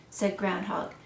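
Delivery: plain reading voice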